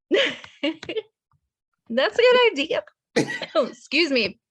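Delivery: laughing through the words; cracking up while talking; laughing hard. Real, not acted. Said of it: "I'm getting choked up".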